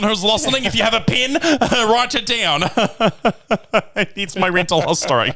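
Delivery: high, laughing through the words; amused aside to the podcast audience, laughing. Real, not acted. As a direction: giggle while telling listeners to note it. Laughing address to listeners.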